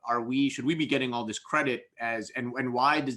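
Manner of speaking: normal talking voice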